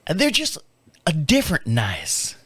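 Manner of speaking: a light Tennessee twang, loaded and knowing; lightly imitated Tennessee drawl on different nice. Neighbor's wife hinting about creepy guests, Tennessee twang. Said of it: "she's got a little twang to her".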